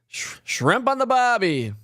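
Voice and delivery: slow high bad Australian accent; badly faked Australian accent. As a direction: try a terrible Australian accent. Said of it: "That's probably terrible, terrible accent".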